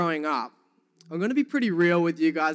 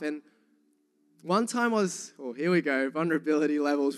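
ordinary delivery

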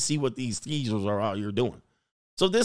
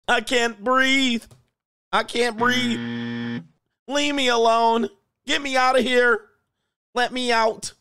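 much higher, slow, hammed-up pleading; mock panicked woman; 7 semitones higher, slower than usual. Choking on gunpowder smoke, she begs to get out in a mock high wail. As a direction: whine to be let out, overplayed.

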